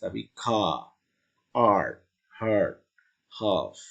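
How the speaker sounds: car, art, heart said with soft r; don't roll the r in car; soft English r. Shown as a demonstration. Teacher models car without strong r. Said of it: "jangan car, R-nya jelas".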